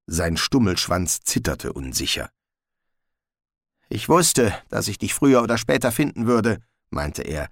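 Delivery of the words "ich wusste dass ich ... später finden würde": high, nervous little dog; a jittery mongrel pleading; talking dog voice. Acted. Gespode the dog speaks.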